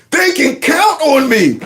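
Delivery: slow, high whining imitation; hammed-up tearful mimicry; mock weepy. Mocks the tearful man's line.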